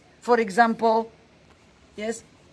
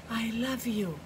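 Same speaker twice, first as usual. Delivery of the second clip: tender, soft confession; 8 dB quieter than usual. A narrator's reading. Phyllis's secret wish to Sibel.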